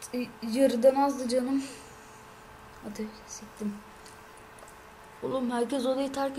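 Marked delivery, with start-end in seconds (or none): 2.8-3.7 whispered curse; whispering a curse to himself. Real, not acted. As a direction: breathe it out under your breath. Quiet aside.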